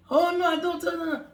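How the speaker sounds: hammed-up no no no; mock protest at being asked; playful refusal. Voicing herself refusing.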